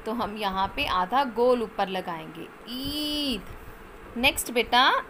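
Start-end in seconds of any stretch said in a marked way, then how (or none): 2.7-3.5 ईद with the ई held long; long vowel demo. Shown as a demonstration. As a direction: drag out the ई of ईद. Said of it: "इसमें लंबी sound आ रही है".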